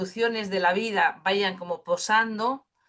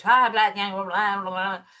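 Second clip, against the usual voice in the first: gibberish babble; 7 dB louder than usual. Shown as a demonstration. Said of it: "tipo explorar la voz con gibberish".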